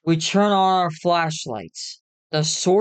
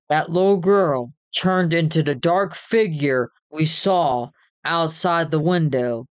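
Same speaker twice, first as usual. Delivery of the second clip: usual speaking voice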